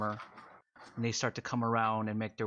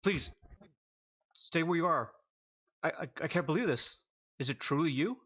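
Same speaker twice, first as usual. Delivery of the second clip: awestruck soldier; 6 semitones higher than usual. Acted.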